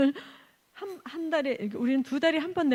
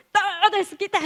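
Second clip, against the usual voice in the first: loud, high mock rage; angry outburst; 7 semitones higher, 7 dB louder than usual. Caught owner turning on the missionary, mock angry outburst. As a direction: act out the owner's temper, half laughing. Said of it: "갑자기 나한테 대려 막 화를 내면서".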